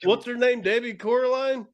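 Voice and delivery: high-pitched, puzzled guessing; retold baffled question. Retells his own baffled attempt at line.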